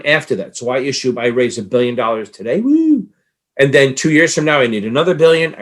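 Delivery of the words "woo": excited whoop. Acted. Celebrating raising a billion.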